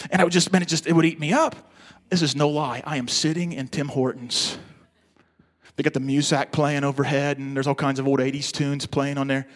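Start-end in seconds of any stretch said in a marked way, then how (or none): none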